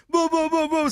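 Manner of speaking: high-pitched gibberish yell; imitated yelling. Trucker rolls the window down at the Uber, mock yelling. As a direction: mimic a burst of angry shouting in nonsense syllables. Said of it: "starts yelling".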